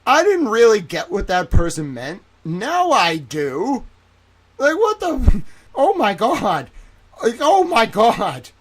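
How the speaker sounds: loud, high outraged rant; outraged yelling. Real, not acted. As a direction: erupt in disbelief, shouting. Outraged rant after realization.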